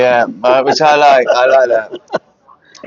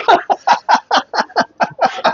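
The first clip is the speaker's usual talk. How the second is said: high laughing burst; burst of laughter. Real, not acted. Laughs at own joke.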